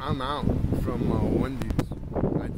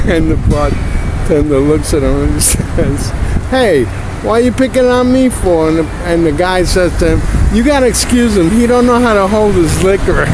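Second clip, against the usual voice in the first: loud, hammed-up joke teller; punchy comic joke delivery; joke telling; 3 semitones higher, 12 dB louder than usual. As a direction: belt out the gag like a stand-up. Announces and tells a joke.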